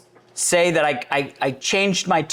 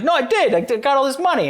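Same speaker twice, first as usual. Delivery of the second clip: insisting excitedly; 7 semitones higher, faster than usual. Retold or imitated. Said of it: "And I was like".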